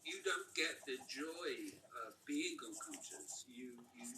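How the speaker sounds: ordinary talk, nothing marked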